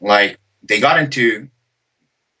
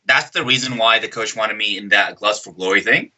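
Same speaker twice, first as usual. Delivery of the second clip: ordinary talk, nothing marked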